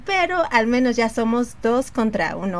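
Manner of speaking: ordinary talk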